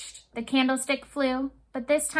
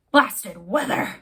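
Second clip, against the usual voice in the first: slow, lower, louder angry growl; captain cursing the rolling sea with a growl; 4 semitones lower, 4 dB louder, slower than usual. Acted. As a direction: grumble it at the storm. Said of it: "he growled".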